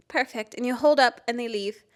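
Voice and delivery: usual speaking voice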